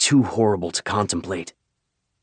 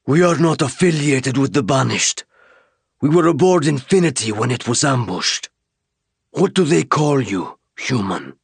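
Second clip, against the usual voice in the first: commanding alien leader; 5 semitones higher than usual. Acted. Alien leader to the stranded soldier: commanding alien voice. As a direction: speak as the one in charge.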